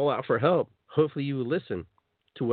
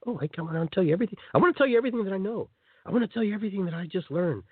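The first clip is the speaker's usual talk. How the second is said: fast, higher, gushing over-eager talk; 7 semitones higher, faster than usual. Pretend. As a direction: spill it all out in a rush. Eager newcomer sharing everything.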